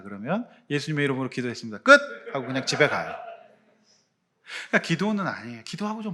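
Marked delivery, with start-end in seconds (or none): 0.7-2.0 fast, careless closing; rattled-off prayer ending. Pretend. Preacher mocking a believer who hurries out of prayer, fast. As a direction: rush through it and snap shut.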